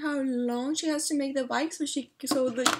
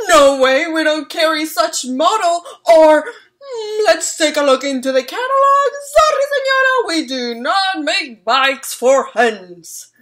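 much louder, higher refusal; loud shopkeepers; 5 semitones higher, 14 dB louder than usual. Acted. Shopkeepers turning down Señora Amelia, loud and exaggerated.

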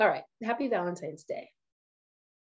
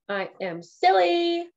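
higher, louder and slowed, stretched out; playful sing-song; 8 semitones higher, 5 dB louder, slower than usual. Shown as a demonstration. Streamer admitting her silliness, higher, louder, drawn out. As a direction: savour it like a happy confession. Repeats phrase twice differently.

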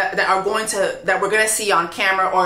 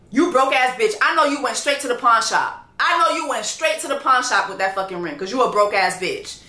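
ordinary talk, nothing marked

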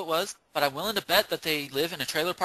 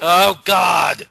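loud groaning outburst; loud exclamation; 5 semitones higher, 9 dB louder than usual. Real, not acted. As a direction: blurt it out loudly in dismay. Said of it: "That's where I say, "Oh, God".

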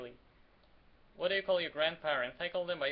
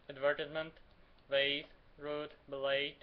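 ordinary delivery

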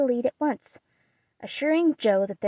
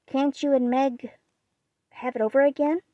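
higher, worried pleading; a touch of worry in a raised voice; anxious question; 5 semitones higher, 4 dB louder than usual. A narrator's reading. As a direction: ask with mild anxiety, pitch raised. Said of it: "asked Beth anxiously".

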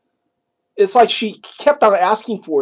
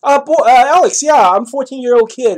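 a hint of an eager teen boy, pitched higher and louder; mock young boy caller; 5 semitones higher, 4 dB louder than usual. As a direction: lightly voice a fourteen-year-old boy on the phone. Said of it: "sounds like a young boy".

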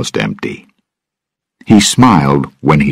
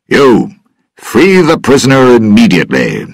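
high, raised voice giving an order; loud command; 8 semitones higher, 4 dB louder than usual. A narrator's reading. Governor at the door orders his officer, loudly. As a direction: demand it loudly from across the room. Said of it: "said a loud voice from the door".

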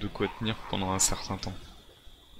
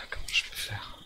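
softer whispered worry; 9 dB quieter than usual. Real, not acted. Gamer hiding in the house, anxious whisper. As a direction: whisper it, afraid to be heard. Hiding, keeping quiet from zombies.

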